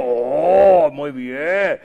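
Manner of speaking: drawn-out admiring ooh; hammed-up respect; impressed approval. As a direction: gush slowly over their profession. Acted admiration of a doctor.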